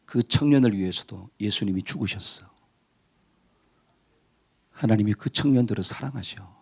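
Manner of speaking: a hint of a low, slowed angel; lightly voiced angel. Lightly voiced angel rebuking the man in a dream. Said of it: "그 천사가 뭐라고 이야기하느냐".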